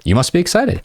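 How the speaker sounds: "You must be excited" pitched high, falling at the end; high start, falling finish on "excited"; provocative statement. Shown as a demonstration. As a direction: assert "You must be excited", not a question. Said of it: "Provocative statement".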